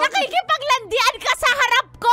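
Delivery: very loud accusing shout; jealous rage. Acted. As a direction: scream the accusation at a boyfriend. Jealous girlfriend accuses him. (nagseselos, galit na sigaw)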